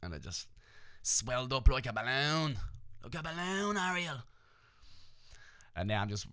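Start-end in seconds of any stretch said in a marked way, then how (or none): 1.0-2.6 silly voice. Pretend. Repeats balloon in silly voice. 3.0-4.2 cartoon mermaid voice. Echoes in Little Mermaid voice.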